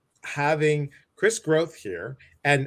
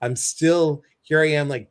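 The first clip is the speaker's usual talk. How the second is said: ordinary delivery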